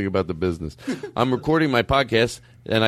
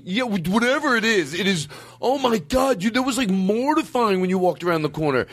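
put-on mortified teenage son; 9 semitones higher than usual. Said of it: "he goes".

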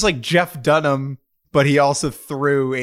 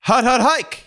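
quarterback calling cadence; 8 semitones higher than usual. Pretend. Opening football segment.

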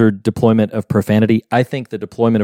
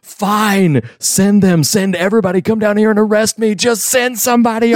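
much higher, fed-up rant; exasperated; 11 semitones higher than usual. Retold or imitated. Hero fed up with the dispatcher, high exasperated rant. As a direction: throw up your hands: send everybody. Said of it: "he gets exasperated with them".

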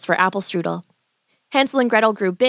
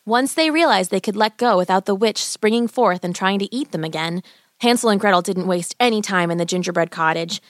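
ordinary delivery

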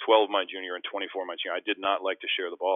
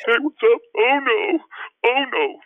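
much higher, loud teasing wail; buddy mocking; 11 dB louder than usual. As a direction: tease him the way his friend still does. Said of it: "my buddy still makes fun of me".

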